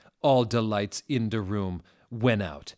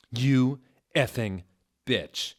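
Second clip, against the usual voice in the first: louder, slow, spat-out insult; angry shout; 4 semitones higher, 6 dB louder, slower than usual. A narrator's reading. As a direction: hurl the curse at her. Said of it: "I shouted angrily".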